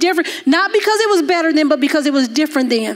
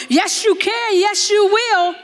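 high, emphatic encouragement; fervent insistence; 7 semitones higher, slower than usual. Retold or imitated. Preacher insisting to her translator, high and emphatic. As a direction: rise up and insist she can.

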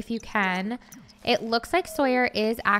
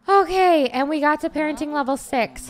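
higher, loud burst of relief; loud relieved okay; relieved outburst; 5 semitones higher, 7 dB louder than usual. Real, not acted. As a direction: let the tension out. Relief after crisis.

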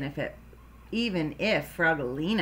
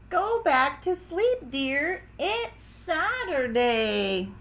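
mother's voice; 8 semitones higher, slower than usual. Acted. Mother answers sleepy household, voiced.

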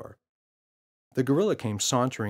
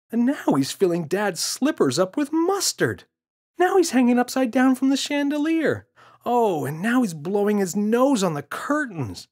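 put-on higher, louder kid voice insisting it's real; a frustrated boy pleading to be believed; insistent boy; 13 semitones higher, 7 dB louder than usual. James voiced in story.

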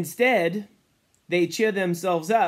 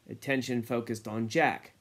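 ordinary delivery

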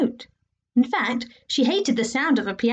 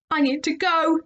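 put-on boy voice; 6 semitones higher than usual. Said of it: "announced Ned".

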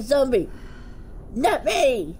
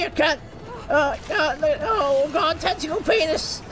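normal talking voice

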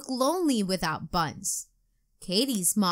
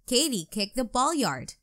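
usual speaking voice

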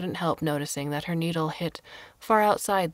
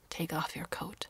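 a lightly voiced old woman, whispered; quiet old hermit telling a guest to settle in; hushed old woman; 8 dB quieter than usual. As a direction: breathe the line like a frail recluse. Old woman speaks to Carlo.

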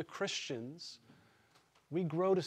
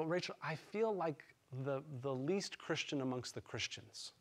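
much softer, low and ashamed; hushed confession; 3 semitones lower, 10 dB quieter than usual. Retold or imitated. Husband confessing to his wife Rachel on the couch, hushed. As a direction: confess it quietly to your wife. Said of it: "I go".

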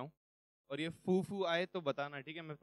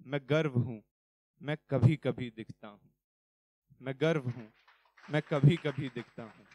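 poem recitation; 6 dB louder than usual. Acted.

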